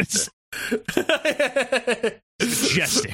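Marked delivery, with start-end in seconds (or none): 2.4-3.1 high, awed narrator; nature documentary voice. Retold or imitated. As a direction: marvel at it like a wildlife host. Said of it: "like a nature documentary guy".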